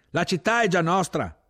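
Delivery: high, loud proclamation; ringing high call; shouted announcement. A narrator's reading. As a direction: shout the news up to the tank. Proclaims to officer over engines.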